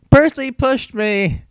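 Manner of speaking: high, slow, shrill-pitched scream of blame; screaming accusation. Acted. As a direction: wail it out like a wronged bully.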